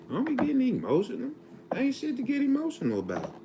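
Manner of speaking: high-pitched, emotional outburst. Real, not acted. Dad brushing off getting emotional while talking to his kids, high emotional voice. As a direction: voice jumps way up high, quick and emotional. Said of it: "Damn, I'm getting emotional".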